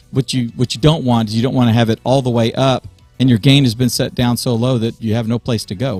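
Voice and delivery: usual speaking voice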